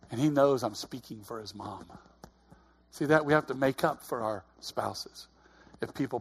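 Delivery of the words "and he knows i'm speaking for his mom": ordinary talk, nothing marked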